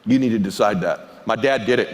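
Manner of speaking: ordinary delivery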